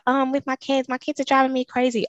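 high-pitched imitation of moaning parents; mock exasperation. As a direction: mimic overwhelmed parents complaining. Acting out exasperated parents complaining.

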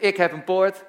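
loud, high-pitched call for the crowd to repeat; rousing call-and-response shout. Shown as a demonstration. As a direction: project it high so everyone joins in. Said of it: "hardop met me meezeggen".

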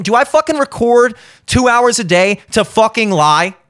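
normal talking voice

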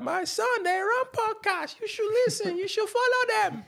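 squeaky high Nigerian mum; proud auntie in a tiny helium squeak. Retold or imitated. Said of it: "Helium voice".

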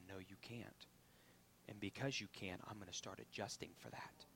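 hushed voice of the body; whispered inner voice. Pretend. Preacher voicing what the body says, whispered.

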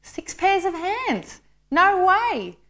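drawn-out, high-pitched disbelief; incredulous angel. A narrator's reading. Angel answering the Lord's tall order, incredulous. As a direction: repeat it slowly, voice swooping high, aghast. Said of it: "shook his head slowly and said".

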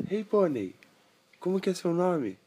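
put-on high calling child voice; little boy voice. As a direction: call out as the boy. Boy calls the pony.

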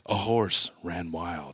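slow, low mock voiceover; grave, drawn-out tragedy narrator; solemn documentary narration. Telling of the racetrack accident like a TV feature, mock-solemn. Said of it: "you know how they always start in slow-mo".

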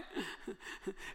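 short breathy nervous giggle; a self-conscious little laugh; awkward laugh. Real, not acted. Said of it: "that's my awkward laugh".

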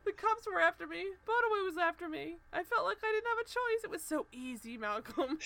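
高声夸张地模仿哭诉; 半开玩笑地演出绝望的坦白; mock crying. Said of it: "she's crying on the balcony".

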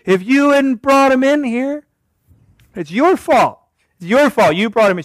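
high, loud mock accusation; blaming wife. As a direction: point the finger at the husband. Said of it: "She's blaming her husband".

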